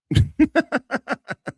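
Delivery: a high, loud cackle of laughter. Real, not acted. Streamer laughing at the clip, loud high cackle. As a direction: cackle out loud, really amused.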